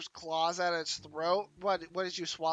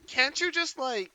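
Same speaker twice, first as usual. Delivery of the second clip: usual speaking voice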